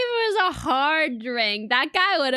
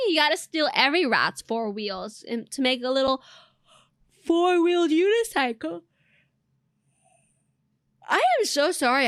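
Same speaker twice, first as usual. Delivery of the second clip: ordinary delivery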